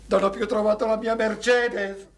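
loud, rising lament; despairing wail. Acted. Man tells friend his Mercedes is gone, wailing. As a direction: break into a melodramatic wail.

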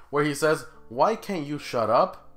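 usual speaking voice

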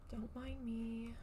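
soft sneaky voice. Real, not acted. Sneaking around in game.

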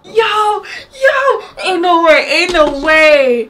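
high, loud shouted amazement; hyped disbelief. Real, not acted. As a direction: shout it, stunned, stretching the last "way". Astonished at surprise character appearance.

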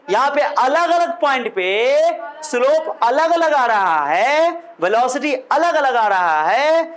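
slow, long-stretched words with swooping pitch; drawn-out singsong. Shown as a demonstration. Teacher showing the class differing slopes, drawn-out singsong.

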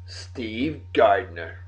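ordinary talk, nothing marked

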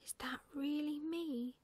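high, slow, awed owlet; small voice full of amazement; disbelieving wonder. A narrator's reading. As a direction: ask it slowly, marvelling. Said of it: "could hardly believe his eyes".

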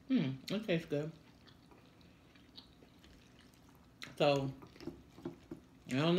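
chewing while talking; speaking between bites; mouth full. Real, not acted. Seen 1: Both diners chewing food throughout; unclear which one speaks.

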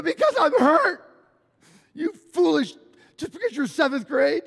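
high, loud crybaby wail; hammed-up tearful protest, yelled out high; mock crying outburst. Hurt boy answering his older friend, mock bawling. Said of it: "I, I'm crying".

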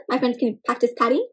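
usual speaking voice

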